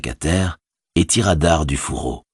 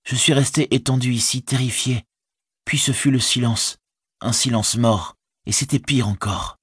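higher-pitched hobbit recounting his ordeal; shaken Frodo; 8 semitones higher than usual. A narrator's reading. Captive hobbit telling his servant of the quarrel, higher and troubled. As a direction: tell it higher, as a frightened captive.

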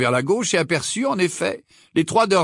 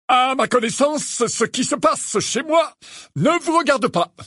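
indignant snarl; 12 semitones higher than usual. Acted. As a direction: bristle and snap back at them. Angry uncle rebuffs wizards.

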